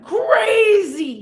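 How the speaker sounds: CRAZY drawn out long and loud; emphatic stress. Real, not acted. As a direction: stretch out "crazy" for drama. Stressing how crazy he went.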